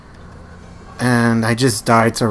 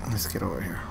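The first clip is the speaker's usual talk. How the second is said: much softer, lower mutter to himself; 5 semitones lower, 11 dB quieter, faster than usual. Real, not acted. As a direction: say it under your breath. Quiet muttering while playing.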